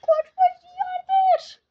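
very high, loud and slow battle cry; real desperate charge; drawn-out yell. Real, not acted. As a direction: roar the ram forward. Final push with battle ram.

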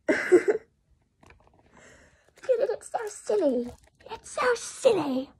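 bubbling over with giddy joy; giddy squeal. Real, not acted. Showing the viewers Tab's mask, a high giddy squeal.

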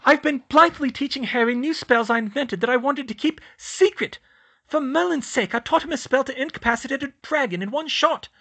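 high, loud, furious student tearing into her headmaster; laying into him. A narrator's reading. Student scolding her headmaster, loud furious tirade. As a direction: Hermione blasts Dumbledore, pitched high and loud. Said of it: "she laid into him".

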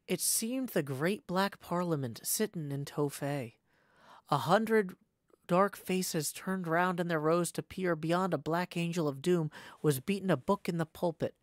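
ordinary delivery

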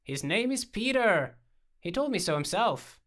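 loud, high, insistent protest; high-pitched emphatic objection; fervent protest. A narrator's reading. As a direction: protest hotly, drawing the words out. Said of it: "Alex protested with fervor".

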